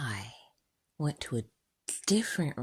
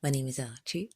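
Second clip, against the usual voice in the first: usual speaking voice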